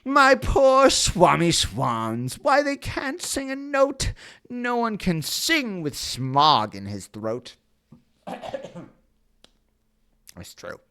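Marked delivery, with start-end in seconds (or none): 0.0-7.5 high, loud grumpy little creature; scolding Lorax. Acted. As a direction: scold him as the cross Lorax. Voices the Lorax complaining.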